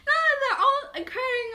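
high, loud amused laugh. Real, not acted. Guest telling host about friends, laughing high.

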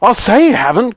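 high-pitched outraged retort; indignant cry. Acted. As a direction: throw it back at him, high and upset. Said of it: "cried Missus Beauregard".

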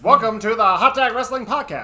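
put-on loud, high old-time radio host; laughing through a vintage announcer bit; 1940s baseball announcer. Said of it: "nineteen forties, like, baseball announcer".

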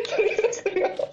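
high squeaky laughing; laughing disbelief. Real, not acted.

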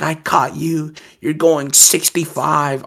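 retold high-pitched accusation; fiending accuser. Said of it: "fiending the fuck out".

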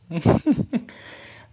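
a sudden, high, loud burst of laughter. Real, not acted. After joking with the sister about baby tips, a loud high laugh.

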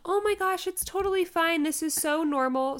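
high, sweet imitation of nurses; retold kindly reassurance; reassuring nurses. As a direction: soothe away her embarrassment.

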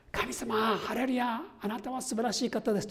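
high, ecstatic praise shout to God; joyful praise cry. Retold or imitated. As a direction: cry out hallelujah in rapture. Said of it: "と叫んでいたのは". (高い声で喜びにあふれて賛美する)

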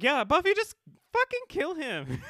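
high, loud urging on with a laugh; egging Buffy on through laughter; excited cheering. Retold or imitated. Fan yelling at the screen for Buffy to kill him.

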